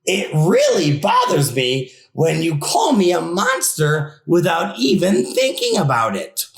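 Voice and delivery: plain reading voice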